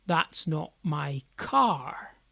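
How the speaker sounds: slow, CAR stressed with a final fall. Shown as a demonstration. Teacher demonstrates emphasis to learners, stress on "car". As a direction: land firmly on "car" at the end. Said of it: "emphasising and de-emphasising different parts".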